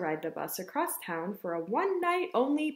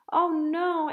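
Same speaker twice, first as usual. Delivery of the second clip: higher mild wail of dismay; dismayed cry; 6 semitones higher, 4 dB louder than usual. Acted. Ava reacts to canceled story time, mildly crying out. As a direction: cry out in a raised pitch, lightly upset. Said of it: "Ava cried".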